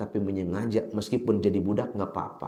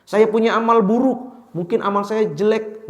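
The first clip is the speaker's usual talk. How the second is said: higher and louder, a village man protesting his bad deeds; pleading villager; 10 semitones higher, 6 dB louder than usual. The villager argues with the Prophet, raised pleading voice. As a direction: press the plea hard, voice raised.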